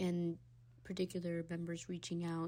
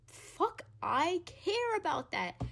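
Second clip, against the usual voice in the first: sarcastic; 10 semitones higher, 6 dB louder, slower than usual. Real, not acted. Sarcastic outburst.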